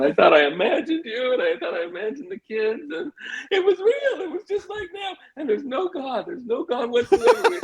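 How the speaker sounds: high, hammed-up tearful babble; frantic rambling. Husband rambling his story to his wife, mock-hysterical. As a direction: blurt it all out, voice climbing into squeaky hysteria. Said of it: "I'm just rambling on".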